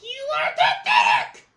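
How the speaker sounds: loud witchy insult; shouting. Acted. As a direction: spit it out loudly. Puppet insults loudly.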